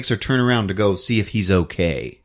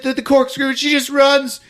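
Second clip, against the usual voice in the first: usual speaking voice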